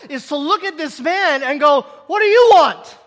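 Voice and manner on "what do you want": loud, high, pointed ask; bold question. Retold or imitated. Jesus asking the blind man, loud and pointed. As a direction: put the question boldly.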